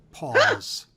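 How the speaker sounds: sudden high, loud squeak on paws; squeaked word. A narrator's reading. Narrator squeaks final word.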